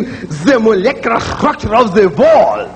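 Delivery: wall said as vall; mock heavy accent on vall; light German accent. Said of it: "they sound real heavy".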